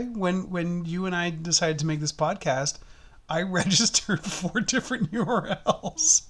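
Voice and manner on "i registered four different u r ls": laughing through the words; giggling confession. Real, not acted. Laughing admission.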